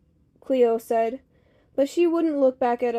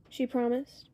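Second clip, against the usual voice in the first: usual speaking voice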